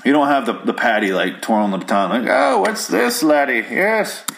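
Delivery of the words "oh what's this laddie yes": attempted Irish cop slipping into a villain; high-pitched, hammed-up movie villain voice; Bane. Irish beat cop twirling his baton, botched into Bane voice. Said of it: "it came out as Bane".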